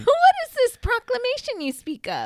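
high, loud mock grand question; hammed-up old-fashioned asking; mock grand questioner.

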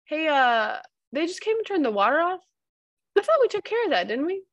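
gently ask, acting out his confusion; imitating a puzzled husband. Retells husband's gentle query about water.

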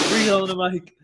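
breathy, right up on the mic; too close to mic. Acted.

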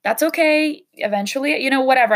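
high, light brush-off, no big deal; a carefree inner voice waving it away; breezy self-talk. Retold or imitated. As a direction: shrug it off lightly. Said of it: "I would have been like".

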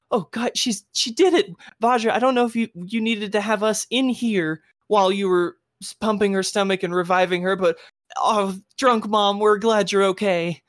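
put-on high-pitched child complaining then relieved; high kid voice, excited at the start; kid's voice. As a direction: play an exasperated child, voice pitched well up. Smee's child speaks to Vajra and mom.

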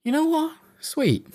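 pleasantly surprised and grateful; delighted. Retold or imitated. Delighted reaction to free device.

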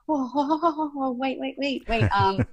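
high-pitched startled protest, rushing to stop them; alarmed "whoa, wait". Retold or imitated. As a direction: throw up your hands, squeaky and panicked. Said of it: "And I'm like".

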